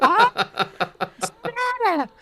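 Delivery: extremely high, slow squeal; shrill. Acted. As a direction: stretch out a piercing shriek. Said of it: "Carly Beth demanded shrilly".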